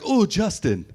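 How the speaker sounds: put-on high, lightly accented welcoming mother; a hint of an African mother's warm invitation; African mom accent. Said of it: "she's like".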